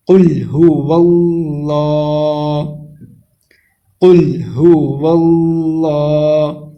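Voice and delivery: قل هو اللہ with the lam held long and slow; slow tajweed recitation holding the lam of اللہ; lengthened lam. Shown as a demonstration. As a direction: stretch the لا in اللہ for a full second. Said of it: "لام کو ایک second کے لیے لمبا کریں گے".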